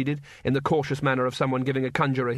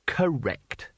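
ordinary delivery, nothing marked

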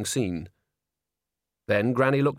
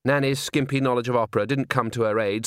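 ordinary delivery

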